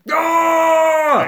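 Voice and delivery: one long, high, loud yell; attacking scream. Retold or imitated. Showing how Chucky sounds attacking, long scream. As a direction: imitate a killer doll's sustained attack cry. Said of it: "when he's attacking, he goes".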